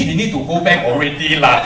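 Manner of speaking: put-on Singlish accent ending on lah. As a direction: say it like a Singapore uncle, Singlish. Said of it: "teach them Singlish".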